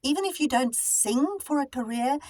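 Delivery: ordinary delivery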